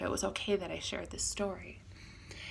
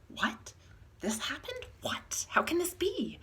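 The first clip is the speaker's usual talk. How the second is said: much higher and louder, stunned and rushing; shocked disbelief; 11 semitones higher, 6 dB louder than usual. Retold or imitated. Friend learns of the harassment and answers in shocked disbelief. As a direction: play it as total shock, voice jumping up high. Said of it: "I was genuinely completely shocked".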